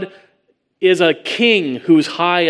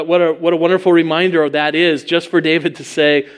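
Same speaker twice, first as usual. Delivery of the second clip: usual speaking voice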